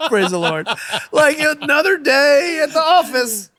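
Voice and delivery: high, loud, laughing delivery. Retold or imitated. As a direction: laugh through the punchline. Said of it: "And he's like".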